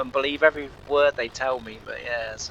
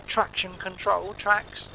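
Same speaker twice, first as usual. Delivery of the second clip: mocking spelled-out logic; 9 semitones higher, slower than usual. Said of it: "He said".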